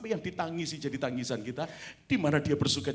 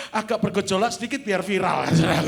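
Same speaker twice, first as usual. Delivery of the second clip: loud joking call; 4 semitones higher, 10 dB louder than usual. Real, not acted. Joking, calling to the congregation.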